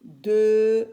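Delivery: "De" loud and long, vowel held; syllable sounded out. Shown as a demonstration. Teacher sounding D with E, "De" loud and held. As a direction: push out "De" loudly and hold it. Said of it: "d-, avec E".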